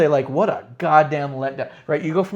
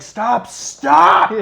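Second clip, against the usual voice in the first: loud, raised-pitch yell; retold screaming; 5 semitones higher, 8 dB louder than usual. Viewer screaming at the Street Profits to stop, retold. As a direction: scream it, building on the repeat. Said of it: "Like, I'm screaming".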